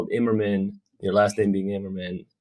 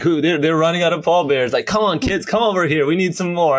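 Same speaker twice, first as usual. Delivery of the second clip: higher mock call to kids across the street; calling kids over; 6 semitones higher than usual. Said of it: "I'd be like".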